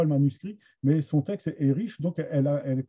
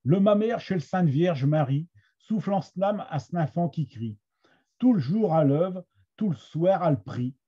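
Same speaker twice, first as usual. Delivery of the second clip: measured Picard verse recitation; Somme Picard accent; 2 semitones higher than usual. Shown as a demonstration. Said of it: "avec, euh, mon accent de la Somme". (accent picard de la Somme, récitation posée)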